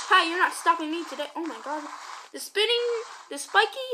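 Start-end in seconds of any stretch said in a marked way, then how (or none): none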